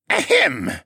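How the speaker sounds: high pompous ahem; throat clearing. Acted. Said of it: "cleared his throat".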